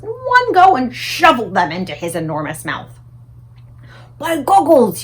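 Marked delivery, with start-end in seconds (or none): 4.2-5.0 high, loud giant exclamation; BFG crying out. A narrator's reading. The BFG crying out with delight over breakfast. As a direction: burst out big like the giant. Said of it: "he cried".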